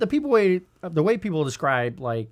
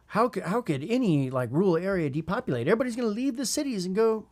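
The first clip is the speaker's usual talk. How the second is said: higher-pitched imitation of doubters; mock incredulity; 6 semitones higher than usual. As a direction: lightly mimic people who can't believe it. Voices the doubters of his prediction.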